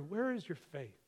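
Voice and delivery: a gentle, probing hush; murmured rebuke turned tender; soft, hushed question. Acted. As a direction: say it quietly to the listener, searching. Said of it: "we need to hear that".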